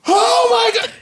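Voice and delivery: very high horrified outcry, retold; losing it. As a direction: shriek it in hammed-up disgust. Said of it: "I lost it".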